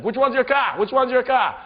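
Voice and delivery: high, loud New England yell; frantic shout. Retold or imitated. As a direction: shout it over a speeding car. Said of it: "had the best accent ever".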